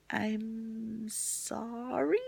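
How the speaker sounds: very slow, soft, stretched-out mock apology; awkward hushed imitation of sympathy; helpless sympathy. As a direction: draw it out uneasily, unsure how to help. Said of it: "I don't know how to help you".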